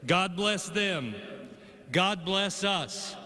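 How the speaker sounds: usual speaking voice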